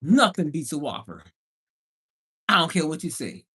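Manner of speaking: ordinary talk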